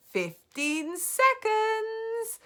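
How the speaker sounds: high, loud, slow witch countdown; gloating cry. Acted. Grand High Witch counting down, slow and loud. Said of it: "cried the Grand High Witch".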